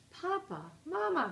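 put-on high, urgent childlike call; excited gasp. A narrator's reading. Cubs burst in calling their parents, excited gasp. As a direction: call out as if a little out of breath. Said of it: "they gasped".

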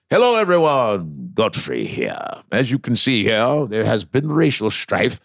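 low, slow British narrator; posh British documentary narrator; British accent. Acted. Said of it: "that British accent".

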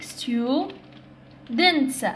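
"Students" drawn out, long gap before dents; split into syllables. Shown as a demonstration. Teacher repeating for learners, "Students" pulled into two syllables. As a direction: stretch "Students" into stu, pause, dents. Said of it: "عندها two syllables كذلك".

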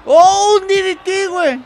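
high, loud cheering shout; excited cheer. Real, not acted. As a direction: cheer the move like a fan. Cheering a wrestling move.

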